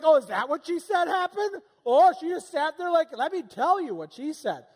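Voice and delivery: high, loud indignant mock voice; other side's version. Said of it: "she's like".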